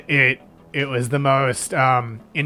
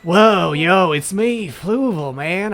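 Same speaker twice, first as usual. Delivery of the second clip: radical-dude character, pitched up; laid-back dude voice; 4 semitones higher than usual. Acted. Trivia master challenges a player, laid-back dude voice. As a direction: play a loose, spaced-out dude. Accent dial turned to another character.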